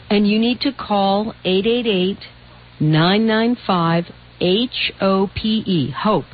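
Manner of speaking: slow, spelled-out number reading; slow dictation. Shown as a demonstration. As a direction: read the number slowly for callers. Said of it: "dictating hotline number slowly".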